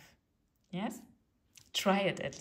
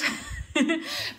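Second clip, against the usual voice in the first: breathy laugh; 11 semitones higher, 7 dB louder than usual. Real, not acted. Laughs about German wordplay.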